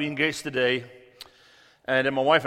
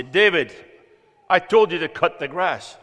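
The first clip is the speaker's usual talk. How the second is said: frustrated dad; 3 semitones higher, 7 dB louder than usual. Retold or imitated. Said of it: "I'm getting a bit frustrated".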